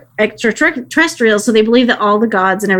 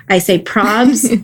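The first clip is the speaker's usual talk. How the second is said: put-on teen slang; much slower than usual.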